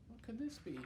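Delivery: ordinary delivery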